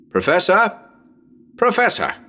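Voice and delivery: anxious calls through a dark house; calling out. Acted. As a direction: call out into the house, growing alarmed.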